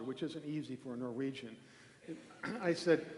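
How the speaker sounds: normal talking voice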